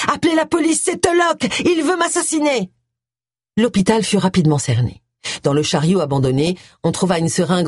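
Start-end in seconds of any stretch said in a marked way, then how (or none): 0.1-2.7 high, frantic shouting for the police; panicked cry for help. Acted. Simon flees the killer toward the desk, screaming in panic. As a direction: cry out in terror, voice pitched high. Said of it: "en criant".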